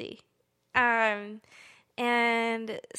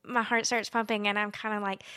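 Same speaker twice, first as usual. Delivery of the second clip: ordinary talk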